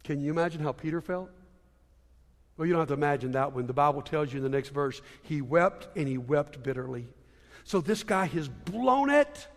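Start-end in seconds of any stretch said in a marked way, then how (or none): 0.0-7.1 low, hushed telling of heartbreak; subdued, grave storytelling; somber. Real, not acted. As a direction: drop down soft and low, letting the sorrow sink in. Somber telling of Peter's shame.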